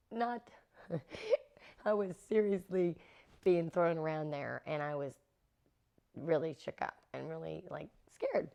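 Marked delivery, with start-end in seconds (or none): none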